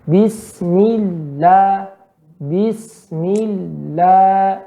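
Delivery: "Bismillah" very slow with a light, thin L. Shown as a demonstration. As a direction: draw out "Bismillah", keeping "la" thin. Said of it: "maka tipiskan". ("Bismillah" pelan, lam tipis)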